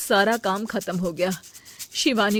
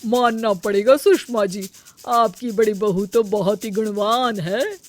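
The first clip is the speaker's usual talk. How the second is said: louder, effusive auntie voice; auntie praising; 7 dB louder than usual. Acted. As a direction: gush over the food like a guest. Auntie praises elder daughter-in-law. (मौसी जी सुषमा जी से खाने की तारीफ़ करती हैं, जोशीली आवाज़ में)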